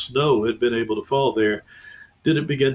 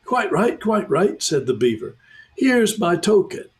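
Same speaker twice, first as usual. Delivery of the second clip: anxious beaver; 7 semitones higher than usual. Acted. Beaver agrees.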